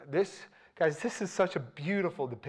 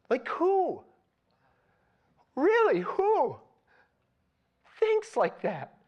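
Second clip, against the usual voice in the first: high, loud, slow preaching, marvelling; preaching at full force; 9 semitones higher, 4 dB louder than usual. Acted. Preacher marvelling to his listeners at David's humility, fervent preaching. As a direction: pour amazement over the congregation.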